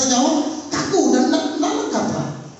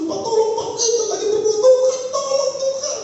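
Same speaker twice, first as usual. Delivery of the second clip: much higher, half-sung plea; urgent prayer; 11 semitones higher than usual. Retold or imitated. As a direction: build into a sung cry for help. Wife and pastor praying urgently. (doa mendesak, tinggi, setengah dinyanyikan)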